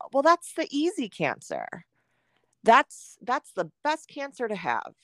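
high, breezy know-it-all voice, mildly mocked; mocking other people. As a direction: parrot people who shrug it off as easy. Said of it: "a lot of people will be like".

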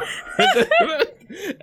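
high cackling laugh; laughing at his own story; high burst of laughter. Real, not acted. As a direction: break into laughter at the memory.

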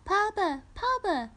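put-on very high repeated toddler calls; excited baby squeal. A narrator's reading. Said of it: "Stevie s-, repeated, excited".

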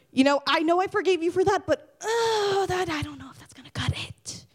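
high hedging, fading away to a near whisper; waver, then trail off in mock doubt; reluctant God. God turns away a forgiven soul, mock reluctant. God hesitates at the pearly gates.

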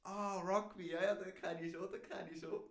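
retold eager recognition. Retelling how an older stranger suddenly recognized rugby, eager imitation. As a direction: mimic his loud "oh, that one!" moment.

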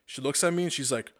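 usual speaking voice

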